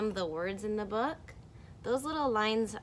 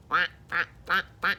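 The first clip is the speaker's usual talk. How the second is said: mock duck sounds; 7 dB louder, much faster than usual. Shown as a demonstration. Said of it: "Can you show me your bill?".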